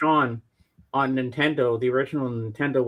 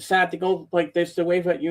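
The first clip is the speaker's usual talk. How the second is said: usual speaking voice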